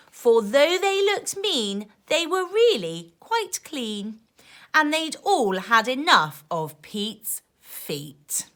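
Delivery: put-on lively rhyming read-aloud for kids; reading to children. A narrator's reading. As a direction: bounce the rhymes like a bedtime book. Reading rhyming picture book aloud.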